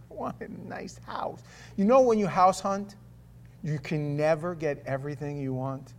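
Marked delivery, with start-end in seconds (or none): 0.1-1.4 breathy longing whisper. Real, not acted. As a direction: whisper it like a secret wish. Whispered wish, unvoiced.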